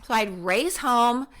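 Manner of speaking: ordinary delivery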